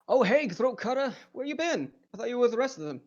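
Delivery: high-pitched, lively lizard man. Acted. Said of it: "the lizard man will notice you".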